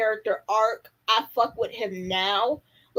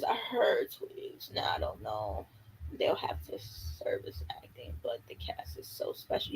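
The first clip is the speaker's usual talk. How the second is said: very quiet, half-whispered reading to oneself; reading under one's breath; 13 semitones lower, 13 dB quieter than usual. Real, not acted. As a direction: murmur the comments to yourself, barely voiced. Reading comments to herself.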